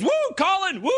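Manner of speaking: high, excited mock whoop; whooping tourist. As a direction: cheer like an overexcited American tourist. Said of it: "this American tourist says".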